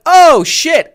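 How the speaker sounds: high, loud burst of discovery; mock-startled exclamation; acted sudden realization. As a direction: replay the moment it clicked. Acting out his sudden realization.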